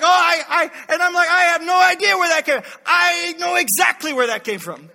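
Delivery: loud, high stammering denial; caught-out dad pleading innocence; flustered protest. Retold or imitated. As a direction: sputter like you've been caught. Flustered excuse acted out.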